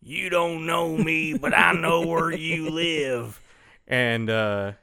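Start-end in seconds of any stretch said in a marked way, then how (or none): 0.0-3.4 slow, mysterious phone voice; slowly disguise the voice on the phone; disguised voice. Retold or imitated. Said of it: "disguises his voice".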